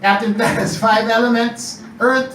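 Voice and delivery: ordinary delivery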